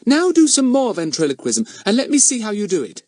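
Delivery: put-on high-pitched, excited child's voice; eager little girl. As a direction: pipe up high like a keen young girl.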